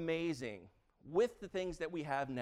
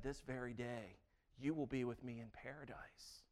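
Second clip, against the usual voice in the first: soft promise; 3 semitones lower, 8 dB quieter than usual. Acted. Jesus answers the thief.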